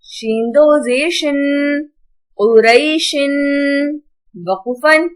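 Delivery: slow, measured recitation with long-held vowels; Quran spelling drill. Shown as a demonstration. Teacher spells out Arabic words for pupils, drawn-out recitation. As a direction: chant each syllable out for pupils.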